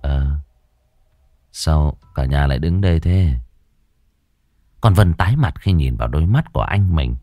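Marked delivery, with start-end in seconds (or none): 0.0-3.3 sleepy voice. A narrator's reading. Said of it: "giọng ngái ngủ".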